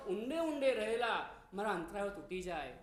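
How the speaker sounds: ordinary talk, nothing marked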